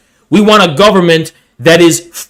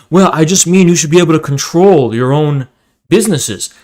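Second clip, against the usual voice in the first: lower, calm know-it-all tone; Vouch voice; 6 semitones lower than usual. Acted. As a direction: play the smug lecturer lightly. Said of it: "Vouch goes".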